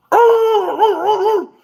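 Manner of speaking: high loud drawn ooh; dog sound, high and loud; imitated dog howl. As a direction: mimic the dog's excited cry. Imitating dog going nuts.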